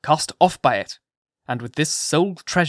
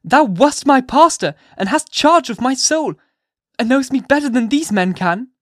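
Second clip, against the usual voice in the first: high, loud, desperate impassioned plea; wild appeal; 11 semitones higher, 7 dB louder than usual. A narrator's reading. Hester pleading with her pastor to save her child, wild appeal. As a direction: beg him wildly, close to madness. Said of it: "At this wild and singular appeal".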